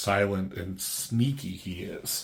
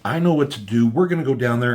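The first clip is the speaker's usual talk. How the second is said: louder, higher, hard-edged declaration; furious resolve; 4 semitones higher, 7 dB louder, faster than usual. Retold or imitated. Superman to Wonder Woman after Comet's death, angry and loud. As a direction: lay down the ultimatum, angry.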